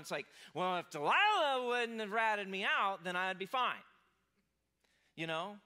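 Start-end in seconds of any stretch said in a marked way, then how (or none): 0.5-3.8 much louder, slow, bitter blame; blaming Samson. Retold or imitated. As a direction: push the fault onto Delilah, loudly. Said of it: "can you almost hear Samson going".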